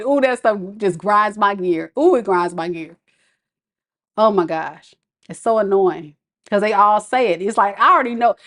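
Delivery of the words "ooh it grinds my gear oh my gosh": ordinary delivery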